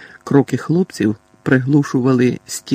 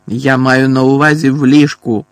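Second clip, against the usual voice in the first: loud, insistent call; 8 dB louder than usual. A narrator's reading. Boy correcting his cousin, loud call. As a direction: call it out forcefully. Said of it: "гукнув Дадлі".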